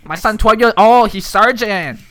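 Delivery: high, very loud broken-English boast; mock immigrant parent voice. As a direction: yell the boasts in a put-on accent. Continues the accent bit.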